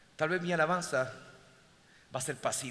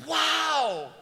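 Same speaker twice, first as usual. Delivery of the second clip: high, long-drawn "wow"; amazement; 8 semitones higher, 4 dB louder than usual. Acted. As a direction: exclaim in wide-open wonder. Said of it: "Eso es asombro". (exclamación aguda de asombro)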